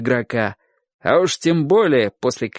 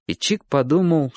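ordinary delivery, nothing marked

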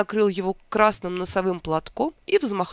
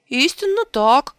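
loud, high, slow exclamation; exclaimed; 7 semitones higher, 7 dB louder than usual. A narrator's reading. Rabbit exclaiming to the king, hiding his surprise. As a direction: proclaim it, hiding astonishment. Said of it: "воскликнул кролик".

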